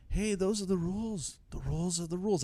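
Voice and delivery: high, softened politician voice; badly faked presidential voice; poor Joe Biden impression. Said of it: "I still don't have a good Biden accent".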